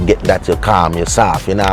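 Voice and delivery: usual speaking voice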